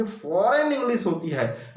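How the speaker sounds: ordinary talk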